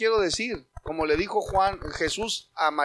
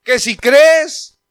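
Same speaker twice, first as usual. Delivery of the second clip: slow, loud, higher rebuke; shout; 6 semitones higher, 15 dB louder, slower than usual. Retold or imitated. Jesus shouting at Martha, slow loud shout. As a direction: cry out the promise to her. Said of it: "Jesús le tuvo que gritar".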